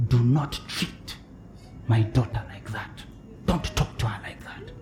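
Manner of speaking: hushed, stern divine warning; softly voicing the Spirit's rebuke; God's whisper. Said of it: "had the Spirit of God whisper to me".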